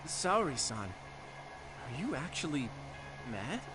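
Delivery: high startled name, then slow, careful question; tentative disbelief, slowing right down; taken aback. Acted. Colleague asks Saori if she is actually mad, taken aback. Colleague astonished at her anger.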